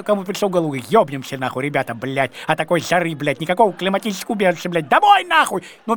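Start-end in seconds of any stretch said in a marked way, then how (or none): 4.9-5.6 shouting to go home; loud, high cry of homesickness; yelling home, half joking; mock shout. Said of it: "первый я, конечно, закричал".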